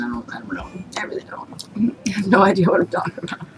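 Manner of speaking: embarrassed whisper. Real, not acted. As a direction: whisper it sheepishly. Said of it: "whispered admission".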